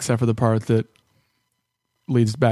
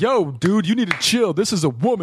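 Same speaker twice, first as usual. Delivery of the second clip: imitating a bro, pitched higher and preachy; mock bro lecture; 7 semitones higher than usual. Friend imitating a bro scolding the angry guy, higher. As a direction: lightly play the bro defending the lady. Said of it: "As opposed to being like".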